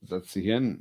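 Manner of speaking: loud German "setz dich hin"; German command. Shown as a demonstration. Owner commanding dog in German, loud. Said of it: "I'll pull out the German".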